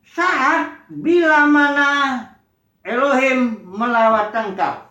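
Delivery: very slow, spaced-out words; slow scripture reading. Acted. Preacher finishing the verse for the congregation, very slow. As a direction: draw out the end of the verse.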